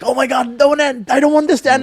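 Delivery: high-pitched pleading fan; retold dismay. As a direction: plead excitedly for it not to end. Fan retelling his dread at the finale.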